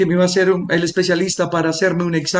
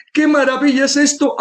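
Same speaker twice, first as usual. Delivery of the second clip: amazed exclamation, higher; 8 semitones higher than usual. Retold or imitated. Speech therapist marvelling at the patient's voice, higher. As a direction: echo ¡Qué maravilla es esto! pitched up with more wonder. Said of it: "levantó las manos y decía".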